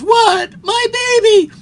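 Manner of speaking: high, loud shrieking woman's voice; frantic woman. Retold or imitated. Crazy woman in hotel answers her name, shrieking. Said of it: "she says".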